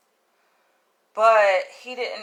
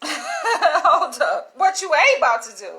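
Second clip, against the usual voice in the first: acted-out indignant challenge, higher and louder; 7 semitones higher, 6 dB louder, slower than usual. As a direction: cut him off with a loud mock challenge. Acting out her indignant challenge.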